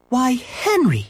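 high-pitched, startled exclamation; surprised mother. A narrator's reading. Mother hearing her son on the phone, surprised. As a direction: jump up high in surprise at who is calling. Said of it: "His mother sounded surprised".

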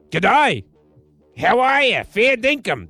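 hammed-up Australian voice; Australian accent. DJ demonstrating how to please Australians, put-on Australian accent. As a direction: lay on a mock Australian accent. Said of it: "doing an Australian voice".

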